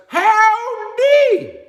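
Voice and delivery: high, long drawn-out cowboy howdy; drawled howdy. Shown as a demonstration. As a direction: stretch it out like a Texas greeting. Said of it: "That's how they say it".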